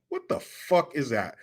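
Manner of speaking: normal talking voice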